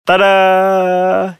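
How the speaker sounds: high showy tada; magician's assistant. Pretend. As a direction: present it like a magic reveal. Magic trick joke.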